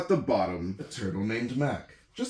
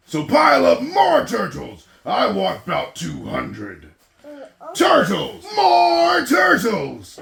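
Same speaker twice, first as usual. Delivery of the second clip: loud, high, drawn-out bellow; bellowing king; 12 dB louder than usual. Acted. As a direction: bray the order to the whole pond. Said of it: "he bellowed and brayed".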